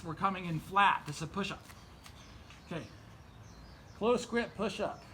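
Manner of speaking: ordinary delivery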